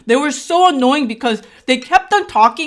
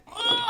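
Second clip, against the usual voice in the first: high, held-back mock pushing groan; straining grunt; 12 semitones higher, 9 dB quieter than usual. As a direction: bear down on a soft groan. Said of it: "and I'm pushing".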